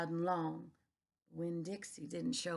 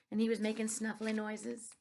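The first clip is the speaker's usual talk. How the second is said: usual speaking voice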